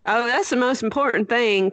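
usual speaking voice

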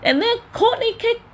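high, giggling retelling; high-pitched giggly telling; laughing. Real, not acted. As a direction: narrate through real laughter.

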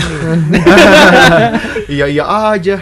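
loud high laugh then giggly words. Real, not acted. Teasing Wiwi for only asking others, laughing. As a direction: crack up teasing a friend.

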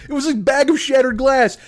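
ordinary delivery

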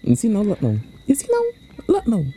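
high sing-song told-you-so; gloating taunt. Pretend. To the one who lost everything, a high taunting singsong. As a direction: rub it in with a singsong jeer.

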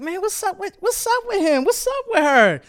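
high, hushed aside; puzzled coworker. Acted. Fred watching a suddenly happy coworker, puzzled. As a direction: murmur it high, as if behind someone's back. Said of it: "Fred over there looking at you like".

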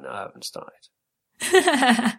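slow, high, drawn-out defiant reply; defiant drawl. Retold or imitated. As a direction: stretch it out, high and cheeky. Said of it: "And I went".

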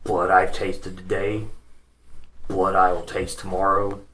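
low, quiet bloodthirsty brute; menacing ogre voice. Acted. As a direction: threaten softly like a brute.

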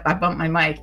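ordinary talk

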